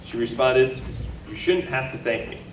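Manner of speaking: ordinary delivery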